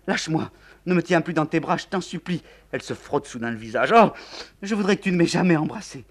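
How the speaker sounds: high, loud, fast desperate pleading; young woman begging to be let go; distraught refusal. Acted. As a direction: break free of his arms. Nathalie pulls away.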